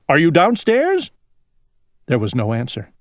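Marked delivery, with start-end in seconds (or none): 0.1-1.1 high, questioning call; storybook holler, pitched up; crying out. A narrator's reading. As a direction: call it through the house. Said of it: "he cried".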